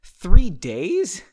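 loud startled question; blurted shock. A narrator's reading. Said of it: "she blurted out".

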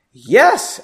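loud, high relieved yes; eager exclamation. Acted. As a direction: jump at the chance. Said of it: "Erin exclaimed".

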